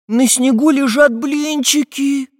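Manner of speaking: high, slow cry of wonder; amazed. A narrator's reading. Prutik spots pancakes on snow, high and amazed. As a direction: call out in astonishment, stretching the words. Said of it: "изумился Прутик".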